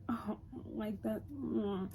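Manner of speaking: soft, pitying murmur; hushed, sorry little reaction; feeling bad for him. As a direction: imitate quiet, guilty sympathy. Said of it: "she felt bad because".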